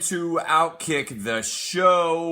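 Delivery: big, slow, high announcer welcome; show host opener. Acted. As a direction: open the show like a booming announcer. Show opener, host greeting listeners.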